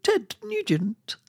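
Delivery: high, slow Scottish female shopkeeper; Scottish accent. Acted. Highland shopkeeper chatting at the post office, high Scottish accent. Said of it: "you did this Scottish accent".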